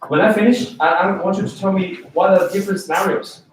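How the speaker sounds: usual speaking voice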